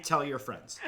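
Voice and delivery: normal talking voice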